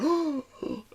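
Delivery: sharp high gasp of awe; excited gasp. Retold or imitated. Fan gasping as the car speeds off. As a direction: gasp like you've seen a star. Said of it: "go like".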